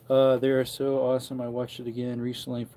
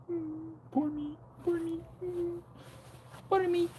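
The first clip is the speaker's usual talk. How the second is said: high falsetto whine of self-pity; mock poor me; 6 dB quieter than usual. Joking about nobody marrying him, falsetto whine. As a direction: squeak it like a sulky little victim.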